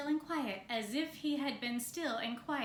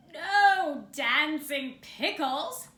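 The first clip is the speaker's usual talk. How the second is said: slow, loud roaring bear; 4 semitones higher, 11 dB louder, much slower than usual. Acted. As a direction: roar it with disgust at dancing pickles. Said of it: "Bear roared".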